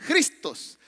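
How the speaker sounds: "Cristos" opened with a Spanish J sound; Greek pronunciation. Shown as a demonstration. Pastor teaching the congregation original Greek, "Cristos" said with initial J. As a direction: say "Cristos" as "Jristós", with a raspy J. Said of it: "mire, con J, se pronuncia".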